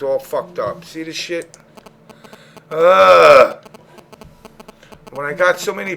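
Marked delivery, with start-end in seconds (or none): 2.7-3.6 loud exasperated groan; frustrated groan. Real, not acted. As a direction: let out an annoyed ugh. Frustrated at messed-up call.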